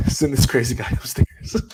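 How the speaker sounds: high voice breaking into laughter; laughing retelling. Retold or imitated. Teller mimicking the doctor's reaction to him, amused. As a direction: quote the order and crack up.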